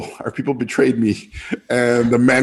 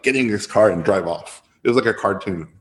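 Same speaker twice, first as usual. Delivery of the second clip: ordinary delivery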